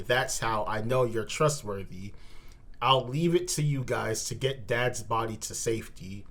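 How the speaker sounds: ordinary talk, nothing marked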